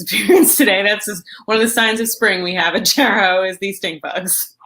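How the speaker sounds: usual speaking voice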